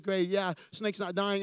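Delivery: usual speaking voice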